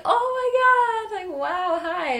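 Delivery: very high, loud, stretched-out amazed gush; stunned delight. Retold or imitated. Hiker meeting the crop duster face to face, high stunned gush. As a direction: gush like you just met a celebrity.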